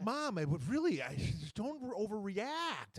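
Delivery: put-on high, whining kid voice to mom; whiny teen pleading. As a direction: plead like a twelve-year-old.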